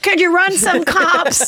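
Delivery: high, slow ditzy client voice; hammed-up naive client; mock client voice. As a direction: play the naive buyer asking the realtors. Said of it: "And I'm like".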